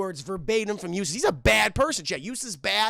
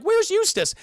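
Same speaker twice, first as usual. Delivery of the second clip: squeaky worried dog; 13 semitones higher, 6 dB louder than usual. Acted. Voicing Courage wondering aloud.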